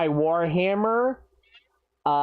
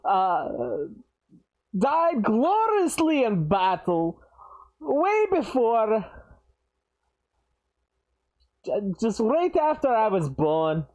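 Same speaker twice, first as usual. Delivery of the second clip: put-on Scottish dwarf accent; 4 semitones higher than usual. Dwarf introduces himself and his late father, Scottish accent. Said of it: "I don't know why I'm doing this accent".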